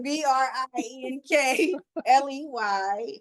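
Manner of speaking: slow, loud letter by letter spelling. Shown as a demonstration. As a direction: spell it out slowly for the room. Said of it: "I will spell my name for you".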